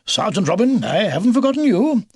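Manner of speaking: high, lifted hearty address; old beaver commander. A narrator's reading. Old beaver reassuring his robin sergeant, hearty. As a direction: call over jovially to a junior. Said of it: "Mister Edgar said to the bird".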